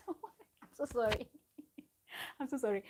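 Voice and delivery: giggling, flustered sorry; laughing, a bit flustered; laughing apology. Real, not acted. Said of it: "Oh, God, I'm sweating".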